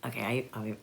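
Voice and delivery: ordinary delivery, nothing marked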